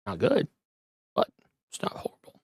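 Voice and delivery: fast whispered aside. Real, not acted. Streamer muttering a price verdict to viewers, whispered. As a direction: drop to a quick whisper, half to yourself.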